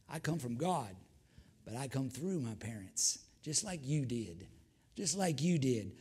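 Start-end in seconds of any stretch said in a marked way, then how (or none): none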